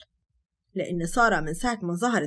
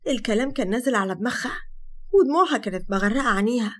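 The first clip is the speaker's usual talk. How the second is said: plain reading voice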